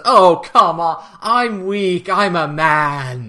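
high, loud, drawn-out excuse; mock whining plea. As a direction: whine for sympathy, hammed up. [name] whining excuses to Eren.

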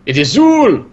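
mock proclamation. Cohost joking about the possessor, hammed-up shout. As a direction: bellow the name like a cult chant. Riffing on the alien name.

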